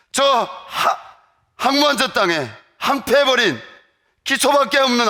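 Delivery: loud, high crying out to God; anguished loud prayer. Retold or imitated. Student pleading with God for North Korea, loud. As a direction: pour out the retold prayer in a loud plea. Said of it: "하나님".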